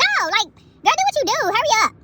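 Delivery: put-on high, pushy girl, hurry; urgent hurry-up. Telling the girl at the store to hurry up, high and urgent.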